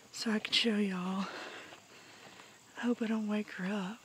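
hushed whisper, trying not to wake her; soft whisper near a sleeping cat; whispering over a crib. Real, not acted.

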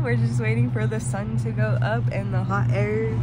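loud, thrilled calling out; projecting over the crowd, buzzing with excitement; excited. Real, not acted. Hot air balloon sunrise excitement.

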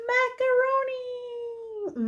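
high silly puppet voice; squeaky character voice for kids. Pretend. Puppet voice for feeding.

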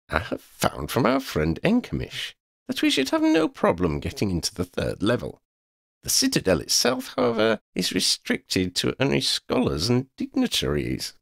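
high, measured scholar's report; Quinn, formal explaining. Acted.

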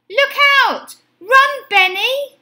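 high, loud cry of warning; alarmed cry. A narrator's reading. Mum warns Benny of the hungry dog, alarmed high cry. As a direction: call out urgently, high and loud. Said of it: "cried his mum".

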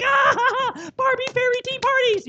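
put-on high, loud squeal of a thrilled little girl; excited little girl. Preacher mimicking his daughter's high excited squeal. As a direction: gush like a toddler at a tea party. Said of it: "It's like, she just gets all excited".

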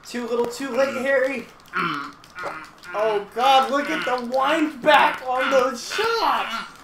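excited shout. Real, not acted. Excited commentary on replay.

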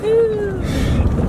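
loud excited cheer; excited woohoo. Real, not acted. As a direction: whoop it out with joy. Cheering while off-roading.

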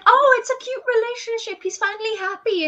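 high mock-sweet gushing; high hammed-up gushing. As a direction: coo like a swooning fan. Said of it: "you guys were like".

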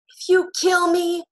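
high, loud, slow shriek. Acted. Ben shrieks at Albie with the arrow aimed at him, high shriek. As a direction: shriek it like a cornered madman. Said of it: "Ben shrieked".

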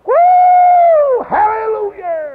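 retold jubilant hallelujah shout, stretched long; joyful holler. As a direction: whoop it out like you can't hold the joy in. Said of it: "And I hollered".

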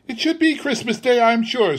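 very high, huffy retort; indignant wife. A narrator's reading. Wife retorts to Bob's reminder of the day, indignant. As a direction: answer back in a huff. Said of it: "said she".

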